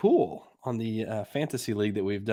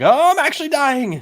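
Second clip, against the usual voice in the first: mock actor panic; 8 dB louder than usual. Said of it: "He's like".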